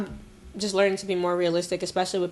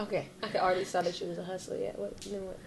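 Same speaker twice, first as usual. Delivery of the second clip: quiet aside; 12 dB quieter than usual. Real, not acted. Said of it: "S1 repeats quietly".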